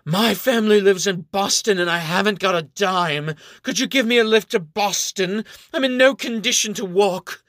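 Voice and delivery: put-on high, loud slurring drunk act; fake drunk. Ariel pretending drunk to the rider, begging. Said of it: "as if she is drunk".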